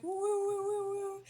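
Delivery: high-pitched wooing sound; imitated woo-woo noise. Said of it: "it's like".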